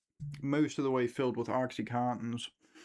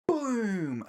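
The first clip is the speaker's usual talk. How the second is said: mock gunshot boom; 8 semitones higher, 3 dB louder than usual. Gunshot described.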